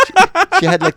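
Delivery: ordinary talk, nothing marked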